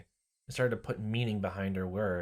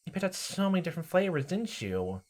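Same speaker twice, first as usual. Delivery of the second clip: put-on creepy girl voice; 8 semitones higher than usual. Rena voiced high.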